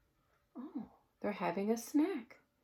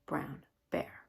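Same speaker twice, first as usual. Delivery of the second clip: soft hushed bedtime reading; storybook narration; 6 semitones lower, 6 dB quieter than usual. A narrator's reading. Reading a picture book to children, hushed storybook voice.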